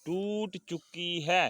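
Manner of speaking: slow, separated words for pupils; deliberate teacher's prompt; slow model reading. Shown as a demonstration. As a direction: draw it out for the class to repeat. Reads sentence for class to repeat.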